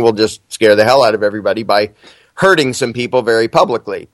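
ordinary talk

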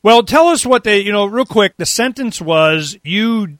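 usual speaking voice

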